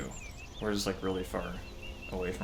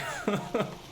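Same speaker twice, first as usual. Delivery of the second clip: high laugh; 12 semitones higher than usual. Real, not acted. Laughing after the fall.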